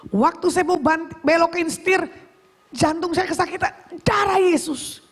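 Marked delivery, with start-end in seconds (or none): 4.0-5.0 high, shouted plea; alarmed cry. Retold or imitated. Driver calling on Jesus as the heart hurts, alarmed cry. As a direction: re-enact crying out for divine protection.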